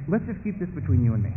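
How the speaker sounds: low, confidential murmur; hushed-down, conspiratorial tempter; whispering beast. Acted. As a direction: share it like a secret. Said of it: "the beast whisper up and say".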